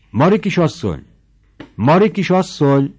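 a voice outside the door calling Mari. A narrator's reading. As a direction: call her name from outside, loud. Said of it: "hangzott Künújból".

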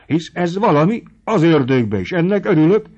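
sokkal magasabban, hangosan örvendezve; ujjongj, ahogy csak bírsz; örömteli felkiáltás. A narrator's reading. Podvolszki örvendezik. (Podvolszki a párbaj hírén hangosan örvendezik)

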